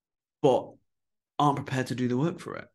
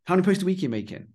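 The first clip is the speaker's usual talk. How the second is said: higher, quick imitated interruption; interrupting; 6 semitones higher, faster than usual. Interviewer cutting across the couple's excuses, imitated interrupting. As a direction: cut in briskly with the question. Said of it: "And he went, interrupted them".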